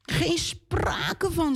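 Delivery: slow, high refusal of the king; angry pharaoh. Acted. Pharaoh refuses Moses, slow and high. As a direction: drag it out like a scornful king.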